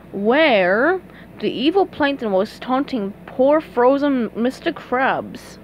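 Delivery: plain reading voice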